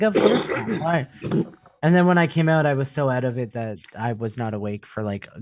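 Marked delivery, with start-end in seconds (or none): 0.0-1.1 high, loud mock disbelief; incredulous. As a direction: act amazed they would even ask. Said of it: "I'm like".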